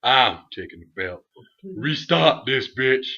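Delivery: imitating a trash-talking star, slow and loud; The Rock impression. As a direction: drag out every threat like a cocky champion. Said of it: "He's like".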